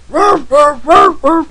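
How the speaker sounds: big dog barking, loud and high; booming ruff ruff; loud barking imitation. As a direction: bark it out like a huge dog at three in the morning. Imitating the dog's loud bark.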